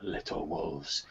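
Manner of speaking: low, slow, rasping menace; sinister rasping voice. Acted. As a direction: rasp it out like a lurking villain. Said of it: "a sinister, rasping voice".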